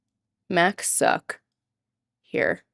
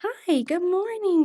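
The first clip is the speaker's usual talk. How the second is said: high, soft, slow greeting; pet talk; 9 semitones higher, 4 dB quieter, slower than usual. Real, not acted. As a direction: gently greet the waking dog. Greeting the sleepy dog.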